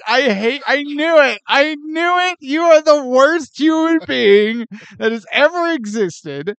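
loud, high playful outrage; mock outrage. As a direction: yell in pretend fury. Mock outrage at deadpan read.

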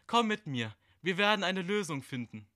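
hohe, lockende Figurenstimme; verführerische Gestalt. Acted. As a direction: ruf ihn mit hoher, fremder Stimme zu dir. (hohe, lockende Stimme einer dunklen Gestalt)

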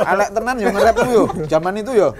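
loud, high laughing tease; laughing teasing. Real, not acted. Friend laughing at old rap verse, loud and high. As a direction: crack up while ribbing your friend.

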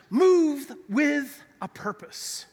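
slow, spaced-out emphatic delivery; emphatic message. Real, not acted. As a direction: drive each word home slowly. Slowly hammers home the sermon's message.

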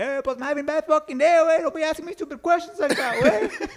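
very high put-on irritated voice; mock mad outburst. Teammate griping at stupid questions, high mock anger. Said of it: "Like, all mad".

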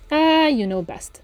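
slow, high, loud knowing "Ah". A narrator's reading. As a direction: draw it out knowingly. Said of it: "said the landlord knowingly".

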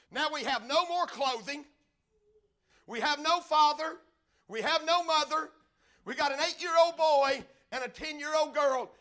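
loud, high, forceful preaching. A narrator's reading. Preacher telling congregation of orphaned children, full-force preaching.

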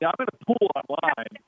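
choppy, cutting-out connection; call dropping out. Real, not acted. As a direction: voice breaking up on a bad line. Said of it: "You're breaking up a little bit".

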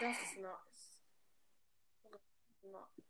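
slow soft whisper. Real, not acted. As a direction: whisper it slowly. Whispered echo.

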